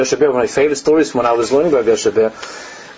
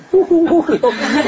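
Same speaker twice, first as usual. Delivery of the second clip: laugh. Real, not acted. Laughing at the story.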